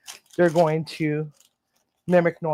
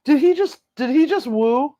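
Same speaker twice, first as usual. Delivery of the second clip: surprised; 10 semitones higher than usual. Real, not acted. Bird just made a noise.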